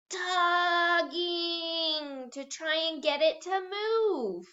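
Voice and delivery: slow, drawn-out, straining as if pulling; acted straining tug. Shown as a demonstration. Teacher acting out tugging for young readers, straining. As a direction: stretch it out like tugging hard. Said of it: "let's try to act out".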